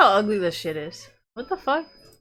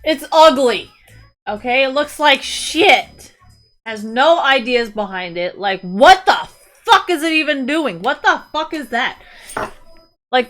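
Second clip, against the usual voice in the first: loud, punchy, disgusted; angry rant; 6 dB louder than usual. Real, not acted. Streamer ranting at ugly skin art, loud and disgusted. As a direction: tear the design apart.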